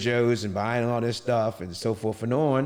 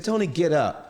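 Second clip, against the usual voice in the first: insistent, higher-pitched retold order; imitating exasperated wife; 6 semitones higher, 3 dB louder than usual. Wife telling husband to stop playing and get up, insistent. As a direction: quote a wife losing patience.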